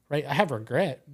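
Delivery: ordinary talk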